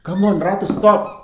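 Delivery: loud, high yell at the dog Ratu. Retold or imitated. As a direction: shout her name to make her stop.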